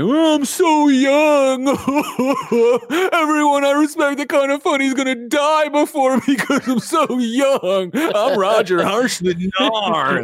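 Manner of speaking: high, loud, drawn-out mock wail; hammed-up whining youngster, stretching the words; Roger. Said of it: "I didn't even start on Roger".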